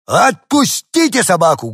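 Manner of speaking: dog barking an order at the women; barked shout. Acted. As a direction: bark it at the ladies. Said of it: "гавкнул я".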